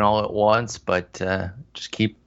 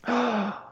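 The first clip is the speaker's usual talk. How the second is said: gasp; 13 semitones higher, 6 dB quieter than usual. Real, not acted. Reacts to quitting smoking news.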